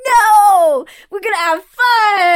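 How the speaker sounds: loud, high, hammed-up drunk boyfriend; mock drunk protest. Retelling her drunk boyfriend refusing to sleep, mock loud whine. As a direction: belt it like the wasted boyfriend who won't sleep.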